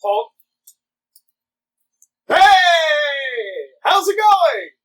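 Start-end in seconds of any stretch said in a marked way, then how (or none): 2.3-4.7 loud, high, jolly welcome; cheerful greeting. Acted. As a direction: boom out a hearty hello. Lancelot greets players cheerfully.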